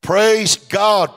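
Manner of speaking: high, loud praise exclamation. Real, not acted. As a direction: shout it to the church.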